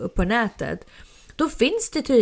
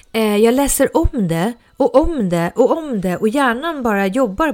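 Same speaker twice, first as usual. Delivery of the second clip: ordinary talk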